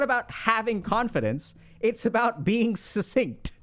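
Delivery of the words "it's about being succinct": ordinary talk, nothing marked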